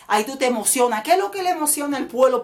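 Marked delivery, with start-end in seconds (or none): none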